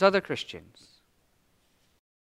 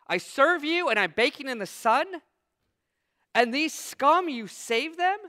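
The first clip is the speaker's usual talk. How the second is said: complaining Jonah; 5 semitones higher than usual. Acted.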